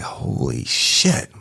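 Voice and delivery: slow breathless whispered oath; hushed horrified aside; shocked whisper. Retold or imitated. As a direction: whisper it, stunned by what you see. Reacting to horrific wound.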